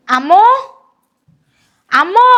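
loud, high, rising shout; yelling for mother. Acted. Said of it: "আরে চিল্লাস কেন". (চেঁচিয়ে মাকে ডাকা)

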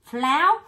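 teacher drilling Flower aloud; syllable demo. Shown as a demonstration. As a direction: punch out Flower beat by beat. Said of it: "mình đổi ngược lại nè".

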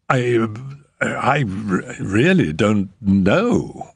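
stammering. A narrator's reading. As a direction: trip over the words, cornered. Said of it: "stammered the house agent".

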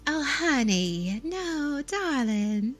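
slow Southern Belle drawl with dropped Rs; Southern Belle accent. Shown as a demonstration. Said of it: "if you're doing the Southern Belle accent".

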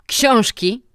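Książki thrown back, loud, high and sneering; mocking. A narrator's reading. Director mocking the teacher's excuse, sneering. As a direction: scoff at the word Książki. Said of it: "zawołał dyrektor szyderczo".

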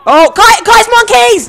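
yelling in alarm; panicked shout. Real, not acted. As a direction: shout a frantic warning.

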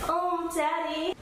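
high, slow, teasing call; a hint of a fake bedroom voice; mock seductive. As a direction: draw it out like a pretend lover. Pranking the husband in bed.

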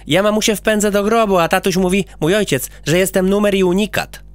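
put-on bragging child; boy character.

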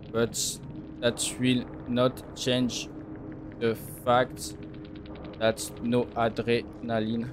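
low, slow muttered no adrenaline. Acted. Streamer mutters the taunt to himself, low and soft.

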